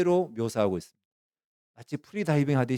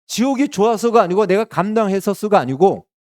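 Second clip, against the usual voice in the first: loud, higher preaching build; impassioned preaching; 8 semitones higher, 9 dB louder than usual. Real, not acted. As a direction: preach it with rising fervor. Preacher at full force. (높고 큰 소리로 열정적으로 설교하듯)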